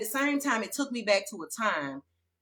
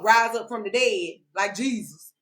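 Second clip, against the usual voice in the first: ordinary delivery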